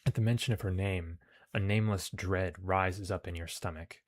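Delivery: measured, projected story reading; story narration. A narrator's reading. Player reads the game's mission text aloud, narrating. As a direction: read it out like an adventure tale.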